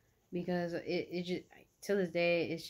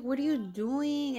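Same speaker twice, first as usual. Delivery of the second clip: pitched high, louder and slowed, mock-appalled; grossed-out question; 8 semitones higher, 5 dB louder, slower than usual. To the boy kissing her mid-meal, high appalled question. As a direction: replay the appalled question higher. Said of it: "I was like".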